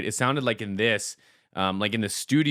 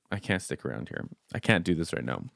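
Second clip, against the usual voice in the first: much softer and lower, near tears; choked up; 5 semitones lower, 6 dB quieter than usual. Real, not acted. Vlogger choked up after the song, soft and near tears. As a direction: hold back the tears. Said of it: "I'm just gonna fall apart".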